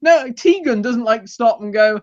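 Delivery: usual speaking voice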